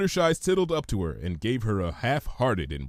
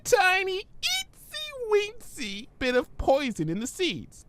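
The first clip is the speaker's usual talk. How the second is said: much higher, bubbly pony; Pinky's voice. Acted. Pinky puzzled by Twilight's big word, high voice.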